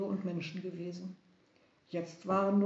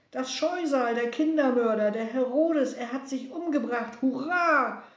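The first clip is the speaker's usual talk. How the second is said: higher, louder cheering; jubilant shout; 5 semitones higher, 8 dB louder than usual. Acted. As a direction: cheer the tyrant's death. Messengers cheering tyrant's death.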